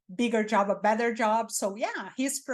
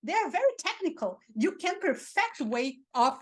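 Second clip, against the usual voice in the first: much higher, gushing with fake enthusiasm; mock excited; 5 semitones higher than usual. Shown as a demonstration. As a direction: rave about dirty diapers like a treasure. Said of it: "you can say anything in this tone of voice".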